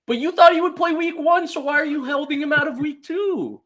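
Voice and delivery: high, loud disbelieving question; incredulous question. Real, not acted. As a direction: ask it in outraged disbelief. Mock question to the coach.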